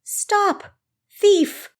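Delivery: a high, loud call for help; desperate cry. A narrator's reading. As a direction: yell it out as if chasing a thief. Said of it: "the desperate cry".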